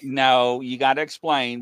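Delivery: normal talking voice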